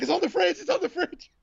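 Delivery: very high, squeaky frantic voice; mock panicked wife pointing him to the fridge; panicky wife. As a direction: shriek it like a flustered wife. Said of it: "She's like".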